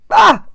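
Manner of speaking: a high happy squeal; excited little squeal. Real, not acted. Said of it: "I sound like I'm four".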